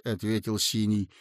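ordinary delivery, nothing marked

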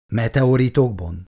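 usual speaking voice

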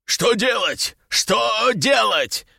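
frantic panicked shouting. A narrator's reading. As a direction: repeat it in rising panic. Panicking brute in cave. (в панике)

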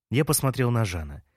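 ordinary delivery, nothing marked

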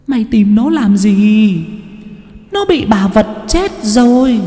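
put-on menacing ghost speaking through the boy; possessed child voice. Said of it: "mà bảo". (giọng ma nhập cao, chậm, rợn người)

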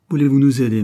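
insistent, pushed-up plea; urgent plea. A narrator's reading. Desperate woman begs relatives for help.

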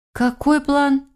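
high-pitched, baffled query; stunned question. A narrator's reading. Granddaughter asks about the grandmother's plan, stunned. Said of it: "в ошарашенном состоянии, переспросила я".

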